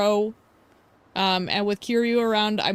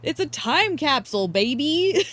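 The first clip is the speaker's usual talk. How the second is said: mock outrage; 6 semitones higher than usual. Gamer defending the classic outfits, mock outraged. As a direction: act scandalized, playfully. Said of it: "and I'm like".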